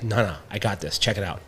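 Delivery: quietly reassure, playing the player; imitated low confiding. Player confides scheme to coach.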